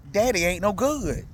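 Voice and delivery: usual speaking voice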